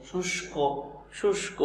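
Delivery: শুষ্ক said as shush-ko, the ষ and ক run together; slow teacher demo of শুষ্ক; shushko. Shown as a demonstration. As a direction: say শুষ্ক with the ক closing the word cleanly. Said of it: "এর সঠিক উচ্চারণটা হচ্ছে শুষ্ক".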